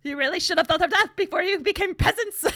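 high, loud theatrical villainess; Yzma. Retold or imitated. Villainess scolding peasants, high loud hammed-up scorn. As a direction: declaim it with mock scorn, breaking into laughter. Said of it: "I gotta do this Yzma line".

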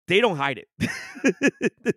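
slow, pointed complaint about league bias; slow emphatic. Real, not acted. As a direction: spell it out slowly for emphasis.

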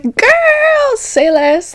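put-on squealed high "girl"; mock excited high squeal. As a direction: squeak it out, thrilled. Her own eager reply retold.

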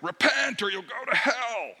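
loud, high mock fire-and-brimstone warning. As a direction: ham up the scary street preacher. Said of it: "some of us might have these stereotypes of".

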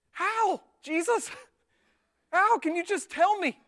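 loud, high exasperated plea; hammed-up freaked-out begging; frantic pleading. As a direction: demand an answer in mock desperation. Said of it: "We're freaking out".

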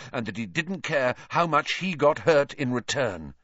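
plain reading voice

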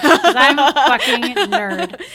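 laughing confession. Real, not acted. Admits to cohost she's a nerd, laughing. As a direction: own the nerdiness with a laugh.

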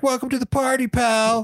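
high mock Bruce Willis line; Die Hard movie quote. Coworkers greeted at work with Die Hard line, high playful mock delivery. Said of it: "we're like".

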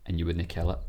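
normal talking voice

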